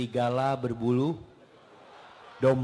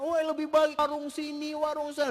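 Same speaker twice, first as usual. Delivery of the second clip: bickering wife; 12 semitones higher than usual. Retold or imitated. Couple bickering over warung.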